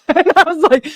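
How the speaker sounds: laughing. Real, not acted. Laughing mid-story.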